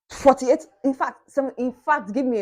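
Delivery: loud exasperated rant. Real, not acted. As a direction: build up the complaint loudly. Ranting about Uniport.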